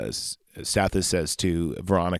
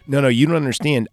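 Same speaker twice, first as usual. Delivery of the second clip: quick, higher, louder pleading; hurried mock protest, voice raised; retold urgent protest; 5 semitones higher, 5 dB louder, faster than usual. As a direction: imitate someone rushing to explain. Retold inventor protests to reporter.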